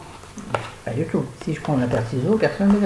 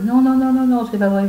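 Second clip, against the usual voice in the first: emphatic protest; 8 semitones higher, 4 dB louder than usual. Real, not acted. Protesting own statement.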